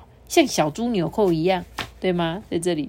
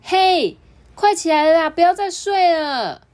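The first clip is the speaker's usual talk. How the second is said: high, loud wake-up call; 10 semitones higher, 7 dB louder than usual. Acted. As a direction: call to rouse a sleeper. Waking sleeping friend.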